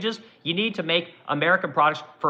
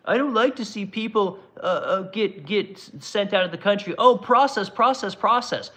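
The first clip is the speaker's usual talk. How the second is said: higher-pitched mock complaint; mocking objectors; 3 semitones higher than usual. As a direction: parody a fretful objector, stumbling over the words.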